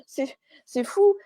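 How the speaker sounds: laughing, pitched high; amused laugh. Real, not acted. Medium marvelling that she is happy on the other side, laughing. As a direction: let a laugh lift the words high.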